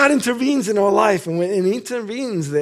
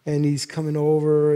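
usual speaking voice

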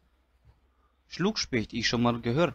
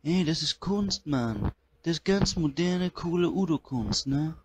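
[name] impression; 3 semitones higher than usual. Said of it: "schönen [name]".

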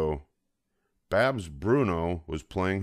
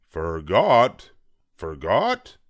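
much louder roar of disbelief; roaring father; 8 dB louder, much slower than usual. Acted. Papa roaring at the cubs for forgetting, loud. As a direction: bellow it in disbelief. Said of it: "roared Papa".

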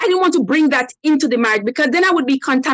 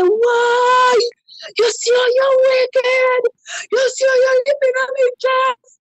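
high, crying mock protest of the husband; mock wailing husband; 12 semitones higher, slower than usual. Woman mimics her husband's fake crying over his chest. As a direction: imitate him wailing that she hurt him.

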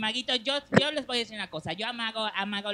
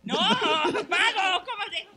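laughing; 10 semitones higher, 6 dB louder, much slower than usual. Real, not acted. Laughing protest at the prank.

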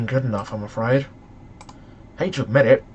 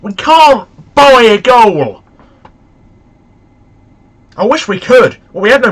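exasperated; 7 semitones higher, 11 dB louder than usual. Real, not acted. Ongoing frustration at missed chances.

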